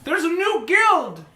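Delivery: high, drawn-out proclamation; excited announcement. Acted. Bartender telling the bar about a new guild, excited announcement. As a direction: announce big news to the room.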